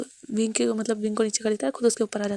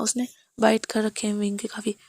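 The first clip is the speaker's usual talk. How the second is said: usual speaking voice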